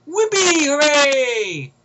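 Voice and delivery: loud, high mock celebration; sarcastic cheer. Reviewer scorning a promised spin-off, sarcastic cheering. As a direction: whoop it up in fake delight.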